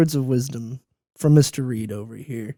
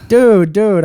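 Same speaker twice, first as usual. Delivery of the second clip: excited; 6 semitones higher, 7 dB louder than usual. Real, not acted. Reacting to a nut joke.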